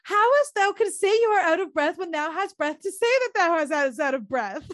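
high-pitched theatrical Shakespearean protest; hammed-up young heroine, indignant; exasperated Juliet. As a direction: imitate Juliet arguing back in high voice. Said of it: "And she's like".

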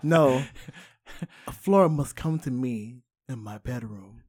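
low, soft, deliberate demand; mock commanding lover. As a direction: imitate a solemn movie madman. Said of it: "It's like".

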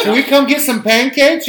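high, loud put-on Canadian voice; mock Canadian accent. Prank caller asks for pancakes, hammed-up Canadian accent. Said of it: "Tom and Jerry call Canada".

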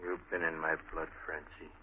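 soft, intimate confession; tender. Acted. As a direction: say it softly, close to her. Marty's soft confession of love.

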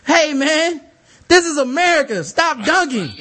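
high, loud retold outburst; indignant shouted warning. Court monitor warns the dunker a second time, indignant shout. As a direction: holler it across the court, indignant.

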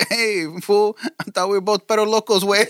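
lightly voiced Chicano slang.

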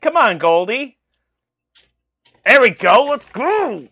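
loud cheer, come on. Real, not acted. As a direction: shout him on. Cheering match on screen.

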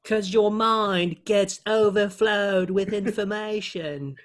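slow, high mock British wisdom; Russell Brand impression. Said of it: "my first Russell Brand impression".

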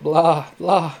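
loud imitated goat-like wail; bleating-goat sobs. A narrator's reading. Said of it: "sounded like a bleeding goat".

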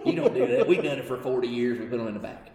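hammed-up grumpy manufacturer; mocking old-timer scorn. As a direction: ridicule the old boys' dismissal.

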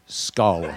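"Skull" drawn out and dropped low; a low, deliberate "Skull"; pronunciation demo. Shown as a demonstration. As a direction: say "Skull" low, as a model to copy. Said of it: "Say it again, [name]".

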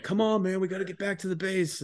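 high, put-on drunk voice; drunk me imitated. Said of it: "and I was like".

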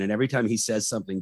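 ordinary talk

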